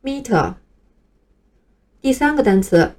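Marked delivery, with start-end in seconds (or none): 0.0-0.5 meter with the weight on "me"; teacher's model meter, first syllable stressed. Shown as a demonstration. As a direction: hit the first syllable of meter, let "ter" drop. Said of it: "第一个音节要发重音".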